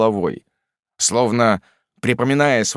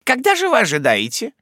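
brisk aunt; 12 semitones higher than usual. A narrator's reading. Aunt questions mother.

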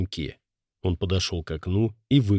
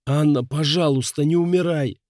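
desperate plea; 7 semitones higher than usual. Acted. Husband begs dying wife.

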